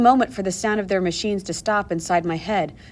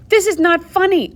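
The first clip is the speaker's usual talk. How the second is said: indignant protest; 10 semitones higher, 6 dB louder than usual. A narrator's reading. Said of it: "I told her".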